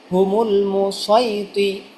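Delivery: drawn-out Quranic reading of হুমুল মুসাইতিরুনা; slow recitation. Shown as a demonstration. Teacher to students, showing the whole word, slow recitation. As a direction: stretch each syllable of হুমুল মুসাইতিরুনা slowly. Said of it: "teacher demonstrates Quran word".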